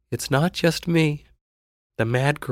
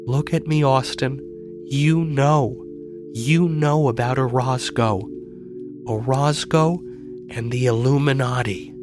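high, intent, voiced stage-confidence; hushed-style urgency without a true whisper; conspiratorial; 5 semitones higher than usual. A narrator's reading. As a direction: let him in on a dark secret. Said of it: "her voice dropped to a conspiratorial whisper".

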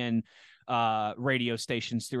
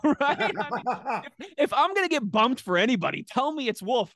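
talking through real laughter, pitched high; laughing; 12 semitones higher, 3 dB louder than usual. Real, not acted. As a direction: laugh your way through the punchline.

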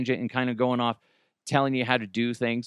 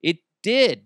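ordinary talk

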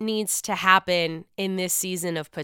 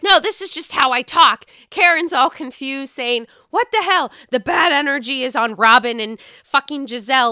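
higher, louder defiant retort; loud insistence; 4 semitones higher, 6 dB louder than usual. Retold or imitated. Giselle answering those telling her to calm down, loud and defiant.

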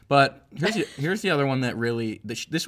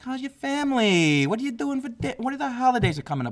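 high, sing-song Midwestern small talk; Midwest mom voice; 11 semitones higher than usual. Retold or imitated. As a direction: chirp it like a nosy Midwest aunt. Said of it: "Midwest is like".

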